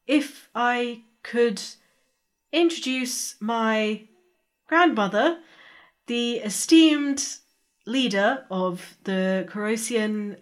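slow, halting politeness with long pauses; awkward formal introduction. Acted. Introducing grandmother and girlfriend to a lady, stiffly formal. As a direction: pick through the introductions carefully and haltingly.

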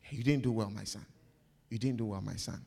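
a fatherly voice chiding gently; gentle rebuke. Retold or imitated. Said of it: "you tell me".

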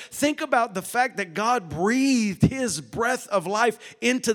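loud, rising sermon climax; preaching at full force. Acted. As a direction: drive it home to the congregation.

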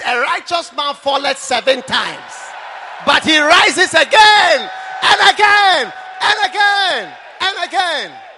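loud, slow, building cry of again; proclaiming the Bible verse to the crowd; preached scripture. Acted.

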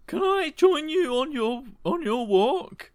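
put-on high, slow little old lady asking sweetly. GM as old lady Margaret, greeting John, high old-lady voice.

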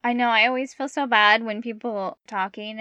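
usual speaking voice

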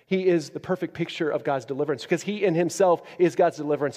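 ordinary talk, nothing marked

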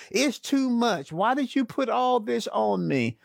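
questions piling up, rising to exasperation; exasperated Moses. Acted. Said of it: "he asks all these questions".

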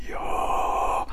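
low, open-throat voiceless whisper; chiaroscuro whisper. Shown as a demonstration. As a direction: whisper with a settled, neutral larynx. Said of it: "Shape an ah".

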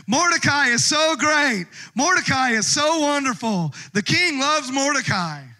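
forced public praise, shouted high; mock herald shout. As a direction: bellow it through gritted teeth like a reluctant herald. Haman forced to parade Mordecai.

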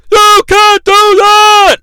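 high, loud and slow, You stretched; drawn-out outraged yell. Retold or imitated. Announcer protesting again, slow stretched yell. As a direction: drag out the protest.

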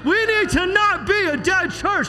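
high, drawn-out preaching shout; screaming preacher. Real, not acted. Preacher rousing a church to wake up, screaming. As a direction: holler it out with full-force urgency. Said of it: "I know I'm screaming".

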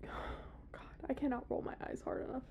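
whispered eye-roll; exasperated whisper. Real, not acted. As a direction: breathe it out, fed up. Exasperated aside, unvoiced.

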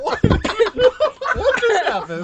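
high, loud laughing question; real laughing confusion; laughing disbelief. Real, not acted. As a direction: ask through laughter.

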